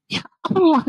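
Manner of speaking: high, dismayed cry to God; shocked exclamation. Retold or imitated. Father dismayed at son eating before old woman, high cry. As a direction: gasp it out in dismay.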